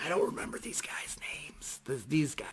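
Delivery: hushed, voiceless confession; whispered aside. Real, not acted. Storyteller to viewers about band names, whispered aside. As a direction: whisper it as if off the record.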